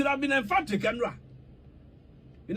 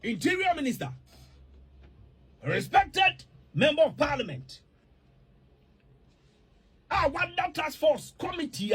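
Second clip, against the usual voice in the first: formal address; 4 semitones higher than usual. Real, not acted. Formal address to minister, committee.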